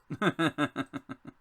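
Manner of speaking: loud rapid-fire giggle; staccato chuckle. Real, not acted. Chuckles at own failed attempt.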